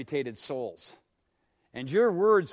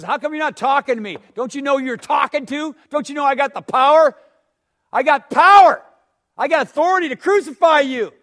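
loud, high, acted outburst of authority; indignant Pilate; 9 semitones higher, 13 dB louder than usual. Pilate confronts the silent prisoner Jesus, loud and indignant. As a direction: throw your power around, louder as it builds.

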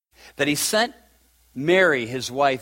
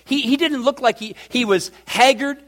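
normal talking voice

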